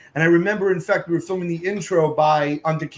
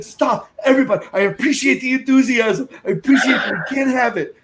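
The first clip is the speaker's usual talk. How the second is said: higher, louder appeal over the noise; pleading with crowd; 9 semitones higher, 6 dB louder than usual. Retold or imitated. Host begging the bar to stop singing, raised voice. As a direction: raise your voice to calm a rowdy room. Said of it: "so I'm like".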